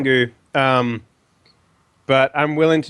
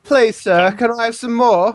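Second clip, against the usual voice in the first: higher, pleading mock-English urchin; poor English boy; 6 semitones higher than usual. Mocking friend's slipped accent, pleading English boy. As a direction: beg in a hammed-up English accent. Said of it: "like, poor English boy".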